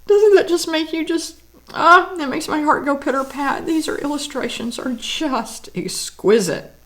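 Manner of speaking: real delight over beautiful illustrations; emotional delight. Real, not acted. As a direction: let the joy spill out, voice lifting. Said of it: "You can tell by the emotion in my voice".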